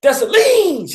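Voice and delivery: high, loud call of the name; child's loud shout. Retold or imitated. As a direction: shout it like an excited little girl. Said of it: "Let her say".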